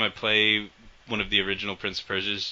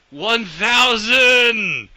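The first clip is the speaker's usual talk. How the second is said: much higher, much louder, very slow mock battle cry; imitating a yell; 13 semitones higher, 11 dB louder, much slower than usual. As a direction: bellow it like a bad dub line. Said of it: "Barrett just yells".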